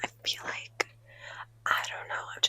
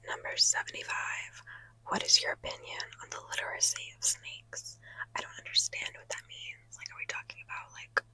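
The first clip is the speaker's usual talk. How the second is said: ordinary talk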